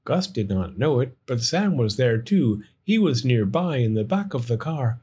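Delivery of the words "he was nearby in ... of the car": plain reading voice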